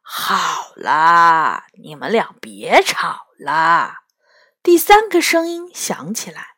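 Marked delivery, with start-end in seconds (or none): 0.1-4.0 low, slow, drawn-out plea; slow, low voice of the patient one; weary peacemaker. A narrator's reading. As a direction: drag it out to calm two squabblers. Third head calms the quarrel.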